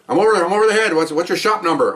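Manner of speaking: lightly acted helicopter observer calling in; helicopter radio call.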